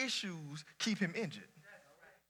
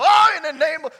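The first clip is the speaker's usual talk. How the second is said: mock baptizing preacher; 6 semitones higher, 11 dB louder than usual.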